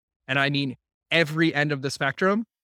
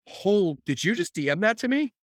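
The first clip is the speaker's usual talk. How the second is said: higher-pitched surprised reaction; retold startled double take; taken aback; 6 semitones higher than usual. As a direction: read it like you can't believe they sent it. Retold shocked reaction to a message.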